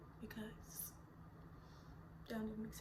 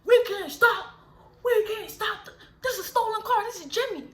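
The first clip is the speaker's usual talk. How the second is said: much higher, loud panicked shout; panicked driver; 9 semitones higher, 10 dB louder than usual. Retold or imitated. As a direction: blurt it out in a rush, scared. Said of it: "So he's like".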